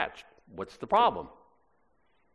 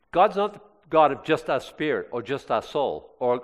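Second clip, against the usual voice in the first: ordinary talk, nothing marked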